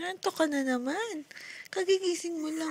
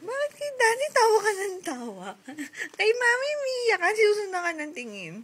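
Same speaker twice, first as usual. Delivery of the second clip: high, louder playful protest; mock complaint; 7 semitones higher, 4 dB louder than usual. Real, not acted. Mother complaining baby prefers Daddy, mock indignant.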